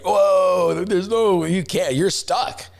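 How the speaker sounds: surprised whoa. Retold or imitated. Dad sees the torn-up road.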